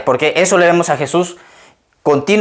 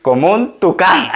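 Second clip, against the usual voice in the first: quoted through laughter. Retold or imitated. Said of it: "luego dice".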